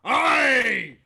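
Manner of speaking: high, loud, long cheer; rowdy cheer. Acted. Dredger cheering a round for everybody, rowdy shout. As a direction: whoop for free drinks.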